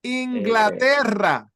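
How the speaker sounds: Inglaterra said slowly with the rr rolled. Shown as a demonstration. Teacher models the rolled R in Inglaterra. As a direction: roll the double R in Inglaterra.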